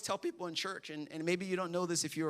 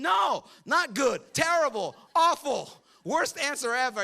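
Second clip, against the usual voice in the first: loud, higher mock outrage at the TV; mock shout at TV; 5 semitones higher, 6 dB louder, slower than usual. Viewer at home yelling at the TV, loud mock outrage. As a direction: yell at the screen from the couch. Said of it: "you're at home going".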